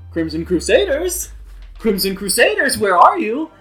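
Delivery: loud, high, sing-out hail; calling out searchingly. Retold or imitated. GM mocking an obvious search around town, hollering. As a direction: holler for them like a lost party, mockingly. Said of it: "going around and being like".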